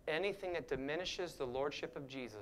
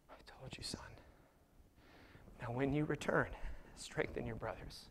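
tender whisper; compassionate; 4 dB quieter than usual. Retold or imitated. Jesus softly comforting Peter in a tender whisper. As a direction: breathe it gently, like to a son. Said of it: "eyes of compassion".

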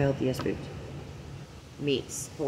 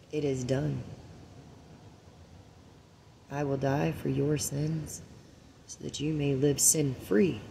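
slow, quiet and reverent; mock-holy farewell, slowed right down; solemn Jesus; 7 dB quieter, slower than usual. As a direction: hush it like last words from the cross. Jesus speaking from the cross.

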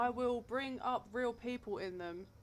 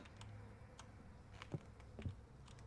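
a sharp breathless gasp of fright; startled gasp; 13 dB quieter than usual. Real, not acted. Player gasps at a jump scare, frightened.

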